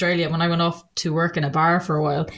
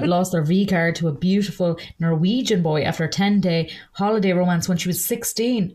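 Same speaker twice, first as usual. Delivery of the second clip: ordinary talk, nothing marked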